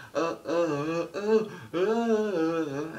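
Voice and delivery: high, loud rhythmic grunting chant; mock crowd chant. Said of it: "Everybody's, you know".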